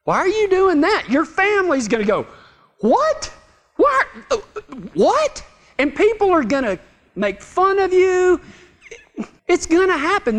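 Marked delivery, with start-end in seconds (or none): 0.0-5.4 high, disbelieving questions; squawked disbelief, pitch leaping way up on each word; incredulous onlookers. Acted. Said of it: "people are going to say".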